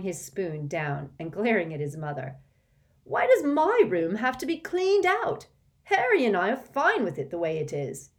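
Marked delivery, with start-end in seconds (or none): 3.0-8.1 put-on loud, high teenage protest; indignant protest. Ron protests to his mother, loud and indignant. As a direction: argue back hotly.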